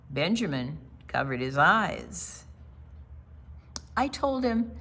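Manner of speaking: ordinary talk, nothing marked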